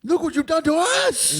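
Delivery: high wounded accusation; hammed-up soap opera grief; mock melodrama. Owner mourning the cat, high hammed-up melodrama.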